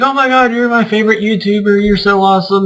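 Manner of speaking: high, fast, gushing squeal that settles down; excited fangirl gush. Retold or imitated. Fan gushing to her favourite YouTuber, imitated as an excited squeal. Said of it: "she's so excited she starts to cry".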